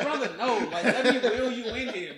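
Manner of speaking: loud, high retold protest; exasperated insisting. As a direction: plead loudly with a stubborn buddy. Retold arguing with stubborn injured friend.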